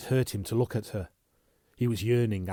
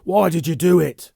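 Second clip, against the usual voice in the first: loud high outburst; loud anguished outburst at the woman he loves; burst out; 8 semitones higher, 12 dB louder than usual. Acted. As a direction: explode at her. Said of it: "he burst out".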